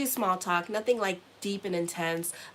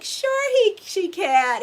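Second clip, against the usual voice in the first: high, loud squealing yes; mom's eager yes; 12 semitones higher, 10 dB louder, much slower than usual. Retold or imitated. Mother answering the rabbi, high loud eager yes. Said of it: "And she's like".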